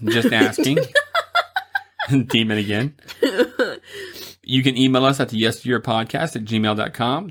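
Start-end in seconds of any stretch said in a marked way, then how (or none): 0.8-2.2 very high squeal laugh. Real, not acted. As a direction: shriek with laughter. Laughing again at the demon.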